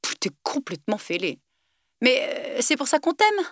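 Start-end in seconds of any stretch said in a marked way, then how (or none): 2.0-3.4 cheerful. A narrator's reading. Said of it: "dit joyeusement Alex".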